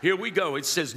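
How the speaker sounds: ordinary talk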